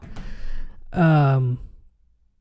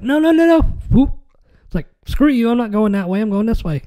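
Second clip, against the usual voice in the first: mock defiant refusal; 6 semitones higher, faster than usual. Game character rebelling at the player who messed up, mock defiant. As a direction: play a stubborn game character refusing orders.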